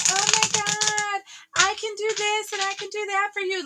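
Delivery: mock a groveling ex laying it on thick; sweet-talking ex. Someone coming back around, pleading in a high sugary voice. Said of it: "coming back around kinda like".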